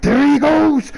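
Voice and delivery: high, loud there he goes; excited spotting. Retold or imitated. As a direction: spot the prey eagerly. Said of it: "I'm like".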